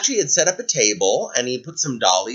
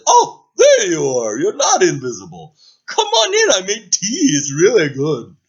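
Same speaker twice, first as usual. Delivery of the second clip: loud, bright welcoming dragon; friendly dragon; 5 semitones higher, 7 dB louder than usual. Acted. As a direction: welcome the knight in gladly. Dragon character voice.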